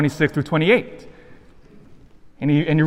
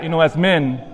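ordinary delivery

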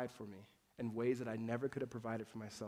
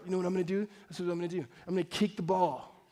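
put-on a boastful kid, getting louder toward the end; cocky junior high boy; 5 semitones higher, 9 dB louder than usual. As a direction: brag like a teenager about an impossible shot. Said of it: "junior high boys like".

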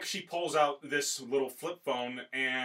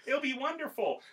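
put-on high-pitched, cheery girl voice; friendly Chica; 10 semitones higher than usual. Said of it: "She's just being friendly".

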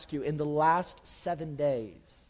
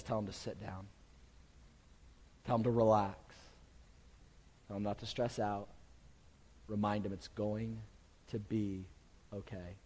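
gentle reassurance; 8 semitones lower, 10 dB quieter than usual. Acted. Jesus calming the hungry crowd.

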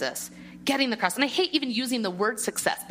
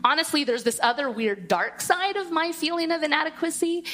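ordinary talk

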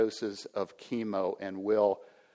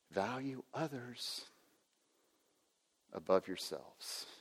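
much softer, slow and tender; gentle quiet quote; 10 dB quieter than usual. Retold or imitated. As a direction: offer the words like a soft invitation. Quoting Jesus's words gently.

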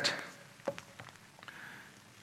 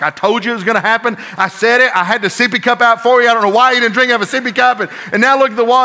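retold told-you-so rant, higher pitched; raised-voice scolding; 9 semitones higher, 7 dB louder, faster than usual. Father scolding his daughter over spilled juice, raised-voice rant. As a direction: scold fast, voice pushed up high. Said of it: "me raising my voice and letting her have it".

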